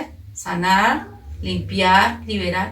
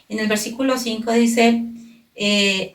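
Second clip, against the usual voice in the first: ordinary talk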